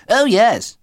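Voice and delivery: high, loud put-on whimper; fake-pitiful little boy. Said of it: "whimpered Tristram".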